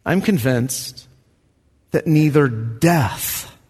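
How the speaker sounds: slow each word down; slow deliberate reading. Real, not acted. Preacher rereading the verse slowly for emphasis.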